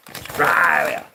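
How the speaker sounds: Australia hollered high as "Straaya"; shouted Aussie "Straaya" for Australia; broad slang pronunciation. Shown as a demonstration. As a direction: drop the first syllable of Australia and stretch "Straaya" loud. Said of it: "And it's spelled".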